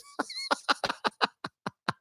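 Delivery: high wheezing laugh; breathless silent laugh. Real, not acted. Laughing at own story.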